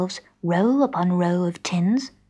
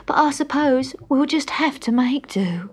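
coaxing tramp; 6 semitones higher, 5 dB louder than usual. A narrator's reading.

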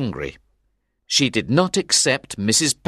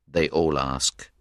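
ordinary delivery

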